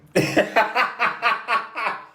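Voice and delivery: long, high-pitched laugh; high staccato peals of laughter; amused laugh. Real, not acted. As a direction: burst into a high cackling laugh. Laughing at own deadpan reply.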